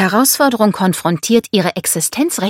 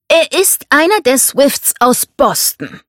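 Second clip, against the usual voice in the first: slow, louder, raised retort; domineering father, countering; 9 semitones higher, 5 dB louder, slower than usual. Acted. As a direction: counter with weighty insistence.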